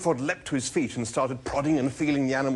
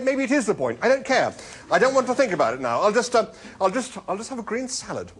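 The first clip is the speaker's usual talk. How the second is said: loud, high, exasperated surrender; flustered; 11 semitones higher, 6 dB louder than usual. A narrator's reading. Arthur retreating to a salad order, flustered. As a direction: give up in a high, stumbling fluster.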